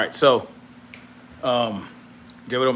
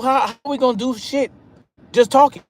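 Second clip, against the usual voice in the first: venting to friends, higher and exasperated; frustrated outburst; 10 semitones higher than usual. Retold or imitated. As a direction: fire off the question in disbelief. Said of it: "I was frustrated".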